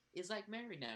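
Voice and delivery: ordinary talk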